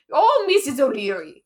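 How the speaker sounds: loud, hammed-up Irish call; big mock-Irish "Oh"; bad Irish accent. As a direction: call out the name in a badly faked Irish accent. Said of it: "in a bad Irish accent".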